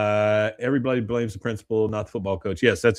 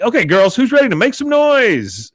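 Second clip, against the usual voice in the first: high, loud, bouncy pep rally call; Peggy rallying the cheerleaders, much higher and louder; peppy cheer call; 12 semitones higher, 5 dB louder than usual. Retold or imitated. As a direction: fire up the squad with a big high cheer.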